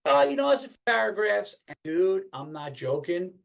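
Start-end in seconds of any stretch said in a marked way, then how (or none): 0.1-1.6 high, offhand imitation of a casual reply; put-on high voice, brushing it off; breezy reassurance. Retelling the answer about the reading, breezy mock reassurance.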